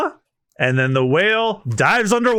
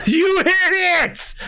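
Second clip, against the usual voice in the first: crew scream; 14 semitones higher, slower than usual. Acted. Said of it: "just all screams in unison".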